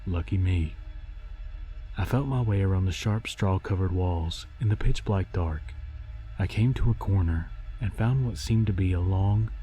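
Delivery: ordinary delivery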